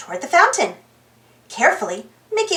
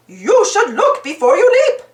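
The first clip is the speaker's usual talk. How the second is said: mild mock shout, a bit higher; 5 semitones higher than usual. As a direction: counterman scolding a frog in the pizza. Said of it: "shouted the man behind the counter".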